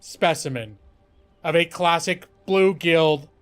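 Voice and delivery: slow, weighty announcement; grand reveal. Acted. Storyteller revealing the lizard to Nick, slow and grand. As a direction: savor the reveal word by word.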